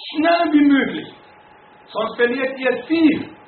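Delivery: high, loud, impassioned sermon voice; fiery preaching. Acted. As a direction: preach it out to the whole church. Preaching to congregation.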